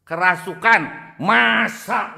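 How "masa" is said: high, loud doubting "Masa?"; a nurse's loud, disbelieving retort. Retold or imitated. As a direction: scoff at the claim as the nurse. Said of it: "suster nggak percaya".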